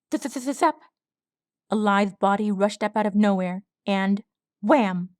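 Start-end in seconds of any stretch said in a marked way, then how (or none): 0.1-0.8 zapping sound effect. A narrator's reading.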